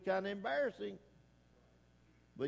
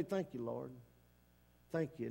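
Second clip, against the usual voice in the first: quiet thanks; 5 semitones lower, 5 dB quieter than usual. Acted. Acted quiet prayer.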